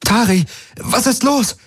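high, alarmed shout; calling over noise. A narrator's reading. Kirai calls to Tari over the noise, alarmed shout. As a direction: pitch it up over the din. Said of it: "rief sie über den Lärm".

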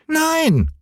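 sehr hohe Kinderstimme, trotzig, nicht lauter; trotziger Häwelmann. A narrator's reading. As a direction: ein trotziger kleiner Junge ruft hoch. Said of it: "schrie Häwelmann". (sehr hohes, trotziges Kinder-Nein)

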